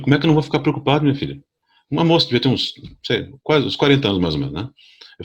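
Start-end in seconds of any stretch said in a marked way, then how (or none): 0.0-1.4 high, fast retold protest; indignant complaint. To the nurse, fast and indignant. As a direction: fire off the grievance in a rush. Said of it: "Falei bem assim pra ela".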